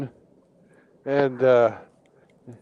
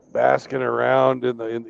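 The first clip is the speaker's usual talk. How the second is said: ordinary delivery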